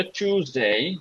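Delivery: টিউজডে with a clear tyoo start, rising; British accent. Shown as a demonstration. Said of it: "ব্রিটিশরা বলে".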